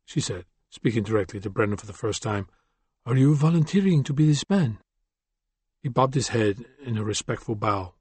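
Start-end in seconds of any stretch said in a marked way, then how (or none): 3.1-4.8 put-on light French accent, cold and firm; cool woman with a trace of French. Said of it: "with just a lingering trace of a French accent".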